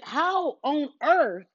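drawn-out, slow, stunned how on earth; stunned disbelief. Retold or imitated. Reacting to a mild comment, in slow stunned disbelief. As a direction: stretch it out in disbelief. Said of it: "I'm like".

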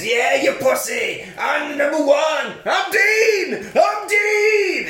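put-on a teen crowing over his win; mock boastful yell. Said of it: "And he says".

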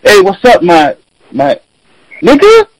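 mocking a poser's greeting, last word way up high; trying-too-hard greeting. Mocking people who just want to say it, high hammed-up greeting. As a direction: ham up someone forcing a cool greeting. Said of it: "some people that are like".

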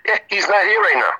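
high, quick put-on child voice; little boy voice. Shown as a demonstration. Man dodging a phone caller, disguised as a little boy. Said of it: "I pretend I changed my voice".

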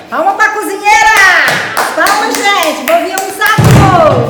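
loud, high cheer; rally everyone to applaud; cheering shout. Real, not acted. Cheering for the cook.

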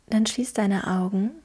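calm, gentle guiding through a visualisation; guided meditation. Acted.

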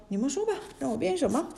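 quick, lively, eager challenge; a showing-off monkey eager to perform; perked up and eager. A narrator's reading. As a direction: rattle it off full of swagger. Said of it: "抖擞精神说".

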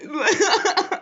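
sudden high laugh; high burst of laughter. Real, not acted. Vlogger laughing at his own petty line.

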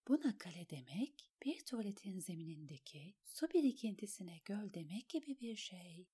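whispered private scorn; hushed, disparaging inner monologue; inner thought. A narrator's reading. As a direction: whisper the judgement to herself. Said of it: "diye düşündü Alain".

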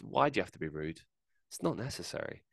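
normal talking voice

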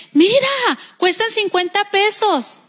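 high-pitched, drawn-out wifely pitch; esposa entusiasmada. Retold or imitated. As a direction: play a wife pointing out a bargain. Esposa muestra una ganga al marido. (esposa entusiasmada con la ganga)

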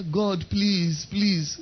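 mock pleading prayer. Mocking begging in prayer.

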